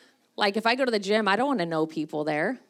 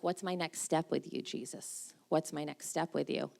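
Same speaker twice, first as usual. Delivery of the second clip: quiet prayerful question; 3 semitones lower, 8 dB quieter than usual. Retold or imitated. Asking Jesus quietly.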